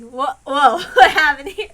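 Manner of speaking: loud burst of real laughter mid-question; laughing surprise. Real, not acted. Reader laughs at a glitch.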